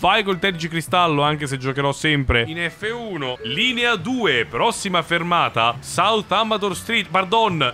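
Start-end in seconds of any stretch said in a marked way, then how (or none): 3.4-7.7 slow, higher-pitched bus stop announcement; announcer's little voice. Pretend. Driver announcing the next stop, mock announcer voice. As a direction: put on a drawn-out announcer voice. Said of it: "faccio anche la vocina".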